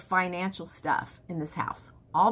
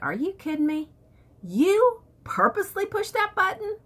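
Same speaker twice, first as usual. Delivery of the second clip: high-pitched retold disbelief; incredulous; 9 semitones higher than usual. Wife retelling how she challenged husband over pushed button, incredulous. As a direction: pitch it up in mock-indignant disbelief.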